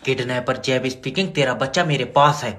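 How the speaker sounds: low, soft gangster menace; menacing kidnapper voice. Acted. Comic kidnapper threat call.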